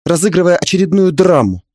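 ordinary delivery

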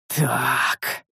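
one long, thoughtful word; drawn out. A narrator's reading. Master takes in the news, drawing it out. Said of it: "протянул я".

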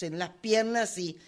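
ordinary delivery

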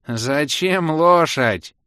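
loud, drawn-out indignant question; high-pitched outraged query. A narrator's reading. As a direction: protest it slowly, high and loud. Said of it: "возмущённо донеслось из-за моей спины".

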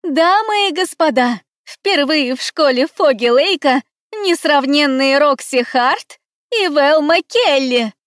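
high, loud showbill announcer; ringmaster. Acted. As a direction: announce the stars to the hall. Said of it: "Голос из-за кулис".

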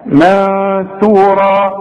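very slow chant with nasal ikhfa; tajweed recitation. Shown as a demonstration. Teacher demonstrating ikhfa before ثاء, very slow recitation. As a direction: recite منثورا very slowly, hiding the نون before the ثاء with ghunna. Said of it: "نون ساكنة بعدها ثاء".

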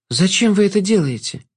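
put-on high girl's voice; high-pitched puzzled question; bewildered young heroine. A narrator's reading. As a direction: ask in a high, pleading tone. Girl questions the Jester.

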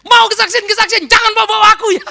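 put-on loud, high furious teen voice; raging complaint. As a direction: storm at your mother after church. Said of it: "Ngamuk lah itu pulang".

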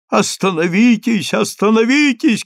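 high, urgent repeated cry; shouted plea to stop. A narrator's reading. Rabbis cry to Daniil to halt, shouted plea. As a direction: call out in alarm, voice rising. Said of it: "кричали Даниилу раввины".

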